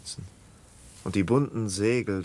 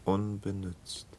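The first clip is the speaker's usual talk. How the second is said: ordinary talk, nothing marked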